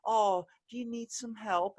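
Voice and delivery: high, sing-song talking-down voice; mock pity for a blind man; condescending baby voice. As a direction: talk to him like he's a toddler. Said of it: "talk to me in, like, this".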